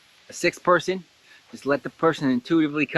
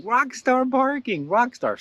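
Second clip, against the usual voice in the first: mock hype; 12 semitones higher, slower than usual. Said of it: "I'd be like".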